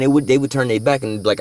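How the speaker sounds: ordinary delivery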